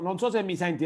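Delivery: ordinary talk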